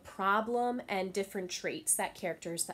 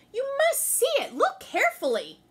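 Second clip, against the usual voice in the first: much higher, louder pushing voice; insistent Anansi; 8 dB louder than usual. Acted. Anansi pushing the deer to see the rock, insistent. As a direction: press her, getting impatient.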